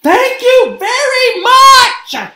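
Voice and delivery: high, loud, drawn-out shriek. A narrator's reading. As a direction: shriek it back at them. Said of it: "shrieked Gabby's mom".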